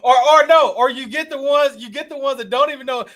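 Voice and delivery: high, loud mocking voice of a crypto newbie; mock clueless newbie. Friend mocks clueless crypto beginners, high and loud.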